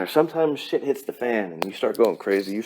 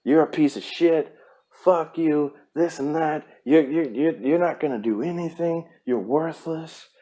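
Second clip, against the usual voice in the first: higher, harsh mock-shouting grown-up berating a kid; abusive adult; 7 semitones higher than usual. Adult berating him as a kid, higher harsh mock yell. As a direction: spit insults down at a child. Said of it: "that is how I was talked to as a kid".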